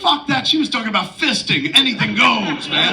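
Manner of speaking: high, pushy mock insistence; imitating Affleck. Actor insists to the director the joke stays, high mock insistence. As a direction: ham up the cocky actor pushing his joke.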